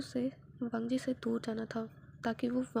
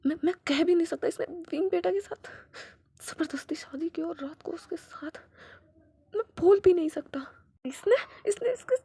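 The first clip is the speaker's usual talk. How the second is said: loud, higher, stuttering fury; choked outrage; 6 semitones higher, 6 dB louder than usual. Acted. Father too enraged to name what was done, loud sputtering. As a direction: sputter with rage, unable to finish.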